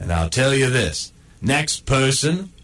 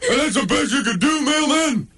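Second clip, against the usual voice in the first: much higher, taunting dog voice; high mocking dog voice; 10 semitones higher than usual. Dog mocks the mailman's shorts, high and taunting.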